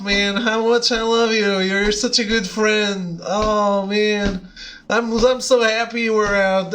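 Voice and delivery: put-on overly affectionate drunk buddy gushing love; friendly drunk. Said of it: "the friendly drunks who are always like".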